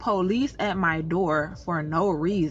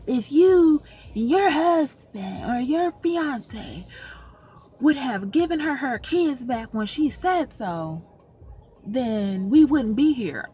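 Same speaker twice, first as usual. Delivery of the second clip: slow, higher mock of a pushy officer; confrontational cop; 4 semitones higher, 4 dB louder, slower than usual. Imitating the policewoman scolding her at the door, slow and pushy. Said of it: "this woman police steps up in my face".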